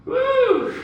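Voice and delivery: high, loud whoop; woo yell. Real, not acted. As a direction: let out a big whoop. Yell after a hard set.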